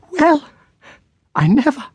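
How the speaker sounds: put-on a flustered aunt seeing the girls off; fussy Miss Jemima. A narrator's reading. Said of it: "said she".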